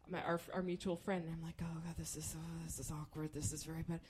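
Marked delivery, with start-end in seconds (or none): 1.6-4.0 low, soft muttered panic; quiet, awkward fretting; hushed dismay. Retold or imitated. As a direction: mutter it under the table. Furtive awkward texting under table.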